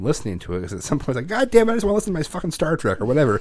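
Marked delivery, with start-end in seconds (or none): 1.3-3.4 high, fast exasperated grumble, bursting into a loud shut-up; agitated outburst. Retold or imitated. Parent snapping at noise in the room, agitated. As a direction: act out fed-up irritation. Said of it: "I get equally as agitated".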